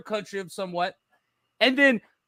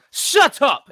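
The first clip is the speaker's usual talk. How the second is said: loud, high retort; hammed-up angry outburst; mock yell; 4 semitones higher, 6 dB louder than usual. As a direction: snap it out loudly as an imitation. Imitates father yelled at by son.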